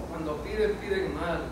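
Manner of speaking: "Cuando piden, piden mal" said low and quiet; soft repeat. Acted. Preacher repeats Santiago's warning to the congregation, low and soft.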